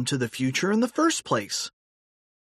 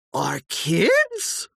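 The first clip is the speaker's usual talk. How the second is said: surprised question; 5 semitones higher than usual. A narrator's reading. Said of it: "asked George".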